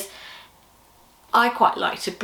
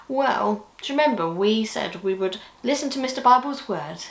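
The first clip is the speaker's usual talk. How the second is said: usual speaking voice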